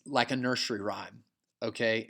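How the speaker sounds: ordinary talk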